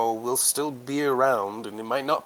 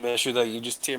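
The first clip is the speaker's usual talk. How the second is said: ordinary talk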